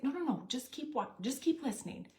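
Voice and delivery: a husband brushing off her doubts, hurrying her along; eager husband. Retold or imitated. Husband pushes her to keep listening.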